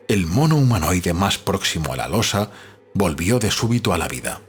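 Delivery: plain reading voice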